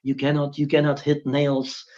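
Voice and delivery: mock soft and proper, needling the box thinkers; mock prim rule-keeper. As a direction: hush it down like a fussy purist. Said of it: "They, they think in the box".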